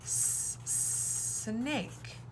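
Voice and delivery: s, s- hissed, then snake; sounding out snake. Shown as a demonstration. Teacher sounding out snake while drawing. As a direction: hiss the s before snake. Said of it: "draw a snake".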